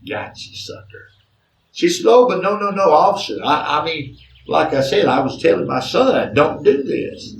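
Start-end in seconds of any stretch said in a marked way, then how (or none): 0.1-1.1 whispered gloat. Retold or imitated. Mother's silent triumphant thought. 2.1-7.3 flustered protest. Retold or imitated. Mother protests to the officer.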